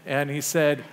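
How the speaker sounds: normal talking voice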